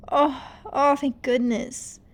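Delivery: relieved sigh, slowing. Acted. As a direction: breathe out the fear. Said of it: "She sighed in relief".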